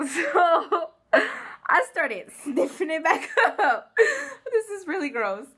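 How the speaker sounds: high-pitched, slow, giggling through the words; can't stop laughing at her own story. Real, not acted. Laughing while retelling gross moment.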